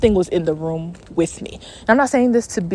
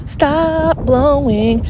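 drawn-out, higher mock order; mock command; 4 semitones higher, much slower than usual. Podcaster playfully scolding the wind, drawn-out mock command. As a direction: stretch each word out at the wind.

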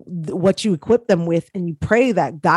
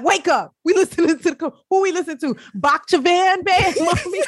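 put-on kid voice; 8 semitones higher than usual. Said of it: "the kids like".

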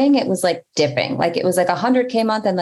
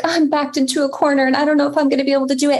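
fast, higher, hammed-up panic; mock panic; 7 semitones higher, much faster than usual. Telling a friend she loves being cornered, fast mock panic. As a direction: rattle it off like you're cornered, half joking. Mocking her own thrill of panic.

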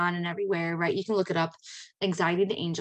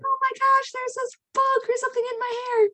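much higher, panicked and squealing; freaking out. Retold or imitated. Retelling her panic about a bug in her ponytail: high squealing freak-out. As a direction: shriek it like something is crawling in your hair. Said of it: "was freaking out".

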